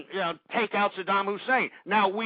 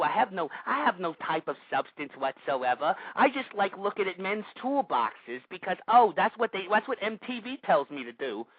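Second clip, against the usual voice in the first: put-on cutesy caller voice, mocking; mocking prank caller. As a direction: mimic an empty-headed show-off caller with contempt.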